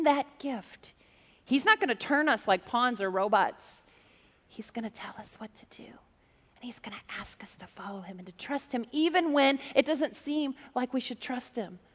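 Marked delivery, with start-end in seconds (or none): 4.5-8.9 whisper. Shown as a demonstration. God's gentle voice.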